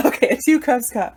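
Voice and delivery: loud, amused laugh through words; giggly self-correction. Real, not acted. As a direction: crack up at your own wording. Laughs at own clumsy wording.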